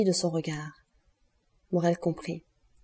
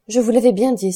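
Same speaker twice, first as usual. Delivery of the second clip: loud, higher cry of told-you-so; exclaiming loudly; 4 semitones higher, 9 dB louder than usual. A narrator's reading. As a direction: cry it out across the room. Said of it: "s'écria madame de Villefort".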